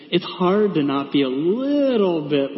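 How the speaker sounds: ordinary talk, nothing marked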